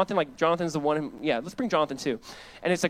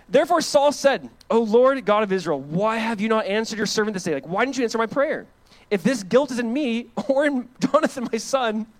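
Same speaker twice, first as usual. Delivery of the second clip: raised, ringing preaching voice; loud scripture reading; 6 semitones higher, 5 dB louder than usual. Acted. Pastor reads Saul's story aloud to church, loud and ringing. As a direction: proclaim the verses to the congregation.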